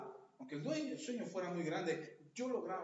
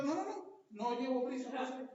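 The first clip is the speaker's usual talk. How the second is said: much higher, fussily polite no no no; mock overpolite refusal; 6 semitones higher, 3 dB louder than usual. Broke customer stalling so nobody hears him ask for credit, high and overpolite. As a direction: stall with exaggerated courtesy.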